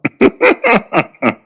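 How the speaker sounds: loud high laugh. Real, not acted. Laughs at his own joke.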